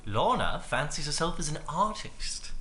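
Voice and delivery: slow, quiet put-on British accent; a mild stab at a British accent; lightly done British accent, drawled out. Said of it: "I was doing an accent".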